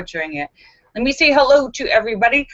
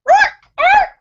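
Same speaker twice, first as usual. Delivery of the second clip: parrot roar; 7 dB louder than usual. Acted.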